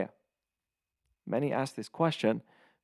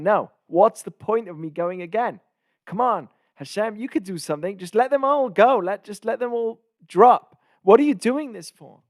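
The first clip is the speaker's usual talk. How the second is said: higher and louder, pleading in frustration; exasperated plea; 7 semitones higher, 7 dB louder than usual. Retold or imitated. Moshe pleading with Hashem to stop sending him, loud and exasperated. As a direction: throw up your hands and beg.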